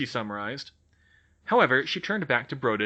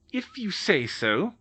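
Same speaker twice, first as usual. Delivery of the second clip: icy; 8 semitones higher, slower than usual. Acted. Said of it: "McGonagall said icily".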